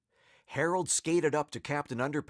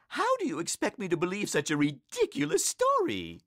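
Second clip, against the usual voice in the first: high-pitched, disbelieving mom voice; scolding mother; 7 semitones higher, faster than usual. A narrator's reading. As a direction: scold a child for telling tall tales. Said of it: "asked his mother".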